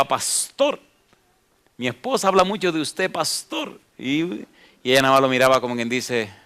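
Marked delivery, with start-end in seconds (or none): 0.0-3.8 "pastor" dragged up high at the end; underlined pastor. Retold or imitated. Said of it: "Y me subrayaba". ("pastor" subrayado muy agudo al final)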